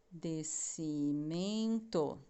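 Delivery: "Decimento" said very slowly in separate syllables. Shown as a demonstration. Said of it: "e o resto embaixo". ("Decimento" bem devagar, em sílabas)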